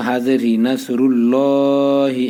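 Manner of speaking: drawn out slowly, holding the long vowel; long vowel demo. Shown as a demonstration. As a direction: stretch the vowel one alif. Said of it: "এখানে এক আলিফ টান দিবেন".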